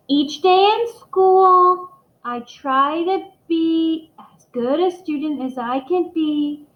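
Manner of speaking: slow, high, word by word; slow reading-aloud voice; slow careful reading. Acted. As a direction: read it out like a nervous child. Child reads poem slowly.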